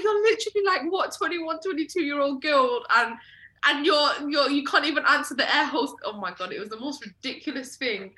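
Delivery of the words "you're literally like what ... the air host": loud, raised-pitch exasperated rebuke; retold scolding. Telling off twenty-something cousin over air hostess, exasperated scolding.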